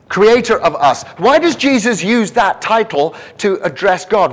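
loud, high, insistent preaching; full-force preaching. Real, not acted. Preacher presses why Jesus addresses God as Father, full-force preaching. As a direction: drive each question home loudly, pitched high.

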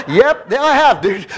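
loud, high, laughing reply; laughing retort. Retold or imitated. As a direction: blurt it out with a laugh. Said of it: "You know, I think".

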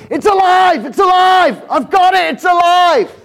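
yelled high and loud, triumphant; "It's alive!" bellowed in triumph; shouting. Retold or imitated. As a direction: shout it out like a street preacher. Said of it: "they'd start shouting like this".

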